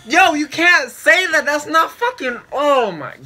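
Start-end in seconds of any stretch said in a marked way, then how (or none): none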